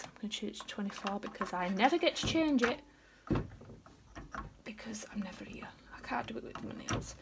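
soft unvoiced whisper. Real, not acted. Vlogger whispers to camera while changing the advent number. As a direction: keep it hushed throughout.

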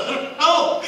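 high, loud puzzled answer; baffled reply. Retold or imitated. Preacher answering the friend, high and baffled. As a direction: throw up your hands in wonder. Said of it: "I said".

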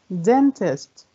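"dentist" as DEN-tist, falling; stress on first syllable. Shown as a demonstration. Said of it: "أول مقطع برضو".

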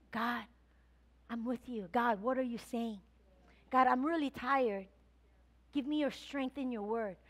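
soft, tender prayer to God; quiet prayer. Shown as a demonstration. Pastor showing the congregation how to pray, soft and tender. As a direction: pray softly and humbly. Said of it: "You know what I didn't do?".

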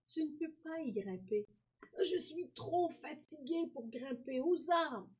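put-on tired old man. A narrator's reading. Old tired boy voiced.

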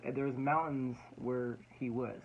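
ordinary talk

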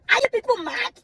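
high, crying out are you people mad; outraged disbelief. Real, not acted. Ranting vlogger cries out at her viewers in outrage. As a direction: wail it in outrage.

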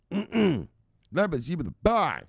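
high-pitched put-on shout-out; silly high celebratory call; playful catchphrase.

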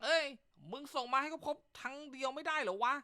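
a husband cursing loud at a careless text; mock outrage. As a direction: blow up at the absurd message. Husband exploding at pregnant text.

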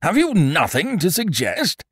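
high, loud impatient demand. A narrator's reading. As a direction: demand an answer, pitch jumping up. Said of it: "said the king impatiently".

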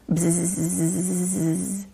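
soft whispered bee buzz. Shown as a demonstration. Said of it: "make a buzzing sound".

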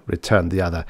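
plain reading voice

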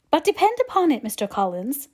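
lightly acted, forceful matron; determined mother. A narrator's reading. Voices Mrs Bennet in story.

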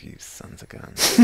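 slow, soft whisper; hushed mock grudge; retold muttered curse. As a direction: whisper it slowly at the deer. Mutters retold remark at deer.

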